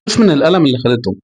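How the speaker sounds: ordinary delivery, nothing marked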